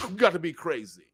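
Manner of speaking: ordinary delivery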